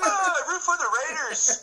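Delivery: high laughing Irish brogue; mock drunk Irish pub voice; Irish drunk cartoon. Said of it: "Irish drunk cartoon character".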